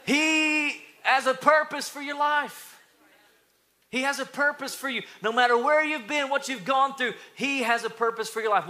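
high, loud preacher's proclamation; ringing, emphatic sermon climax; impassioned preaching. Real, not acted. Preaches at full force.

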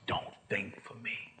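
slow, soft, weighted words; quiet, deliberate admonition; hushed warning. Shown as a demonstration. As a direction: drop the volume and space out each word. Slow hushed second take of instruction.

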